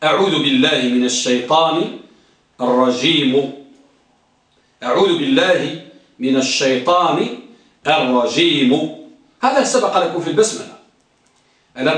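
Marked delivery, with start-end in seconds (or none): 0.0-3.5 low, measured recitation; low rising recitation of أعوذ بالله; recited formula. Shown as a demonstration. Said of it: "ها،". 4.8-9.0 recited formula again. Shown as a demonstration. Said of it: "ها،".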